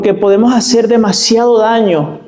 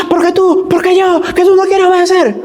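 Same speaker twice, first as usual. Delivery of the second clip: mock furious parent; 12 semitones higher than usual. Parent yelling while hitting child.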